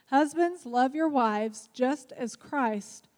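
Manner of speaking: slow, measured reading of scripture. Acted. Husband reading the verse aloud to the group, slow and solemn. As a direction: read it slowly, with weight.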